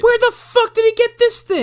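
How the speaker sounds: very high, loud outburst of disbelief; incredulous shout. Real, not acted. Host baffled by rifle from back pocket, incredulous shout. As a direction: yell it in comic outrage.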